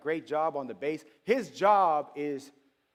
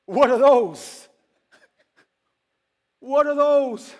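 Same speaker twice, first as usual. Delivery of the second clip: loud, high, drawn-out heckle; stretched, high-pitched holler; mock outraged shout; 9 semitones higher, 12 dB louder, much slower than usual. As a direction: shout it out in comic disbelief. Acted mocking shock at neighbor's shoes.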